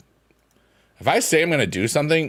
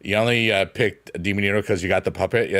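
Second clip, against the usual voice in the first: ordinary talk, nothing marked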